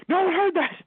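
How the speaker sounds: high, loud mock panic; hammed-up alarmed shout; panicked yell. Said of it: "And I'm like".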